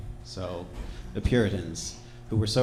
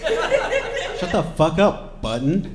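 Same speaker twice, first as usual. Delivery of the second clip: higher, slower, more forceful put-down; escalated mocking dismissal; 8 semitones higher, 4 dB louder, much slower than usual. Revolutionaries silence Button harder, mockingly exasperated. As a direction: drag out the mock order.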